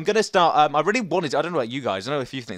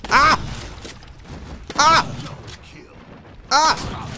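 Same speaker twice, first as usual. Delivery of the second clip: scared yelp; 10 semitones higher, much slower than usual. Real, not acted. Gamer scared during play, sharp yelps. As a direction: yelp in sudden fright. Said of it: "apparently [name] can get scared".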